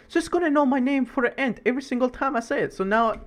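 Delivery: usual speaking voice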